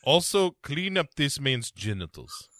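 hammed-up Russian boss, voice held apart; a lightly put-on Russian villain, amused; Russian villain accent. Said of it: "the screaming and the yelling and the Russian accent".

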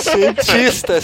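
laughing. Acted. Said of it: "teasing echo of greeting".

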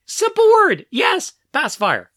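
high-pitched mock pleading with a toddler; exasperated coaxing. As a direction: act out a parent begging, voice climbing very high. Exasperated parent coaxing stubborn child.

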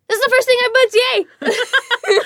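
high, loud joyful cheer; excited announcement. Retold or imitated. New actress announcing her first booked role, high loud cheer. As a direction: celebrate the first booking. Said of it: "And I was like".

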